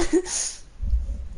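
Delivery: high, breathy burst of laughter. Real, not acted. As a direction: laugh out at the memory. Laughing at own story.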